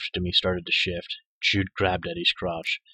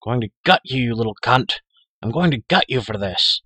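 loud panting threat, pitched up; furious threat; 4 semitones higher, 8 dB louder than usual. A narrator's reading. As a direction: spit out a furious threat, half out of breath. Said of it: "he panted".

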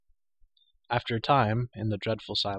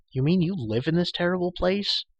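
shocked; 8 semitones higher, 3 dB louder than usual. A narrator's reading. Taryn shocked.

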